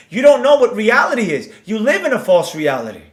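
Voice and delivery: usual speaking voice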